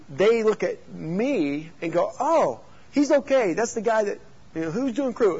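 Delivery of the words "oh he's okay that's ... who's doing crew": put-on kid voice. Kids voiced, far high slow.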